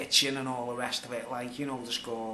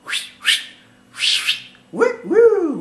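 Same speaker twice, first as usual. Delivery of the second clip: whistled whoosh rising to a high loud whoo; wolf whistle; 13 dB louder, much slower than usual. Shown as a demonstration. Demonstrating a wolf whistle at a passing girl, loud and high. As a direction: mimic builders wolf-whistling at a passing woman. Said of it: "wolf whistle".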